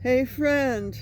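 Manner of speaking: put-on high, drawn-out call of an older stranger; older man calling out. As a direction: imitate an out-of-it older guy calling. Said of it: "He sounded like an older guy".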